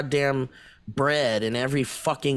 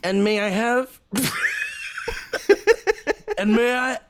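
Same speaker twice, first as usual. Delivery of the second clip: put-on higher, slowed Australian accent; mock Australian; 6 semitones higher than usual. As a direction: order a slushy as a cartoon Aussie. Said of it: "our impression of an Australian".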